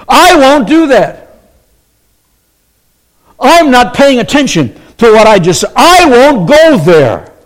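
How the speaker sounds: stubborn, indignant refusal; Peter refusing God. Retold or imitated. Preacher voices Peter defying God, indignant. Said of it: "he is saying to God".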